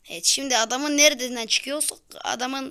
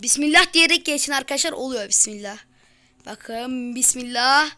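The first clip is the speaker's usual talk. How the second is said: usual speaking voice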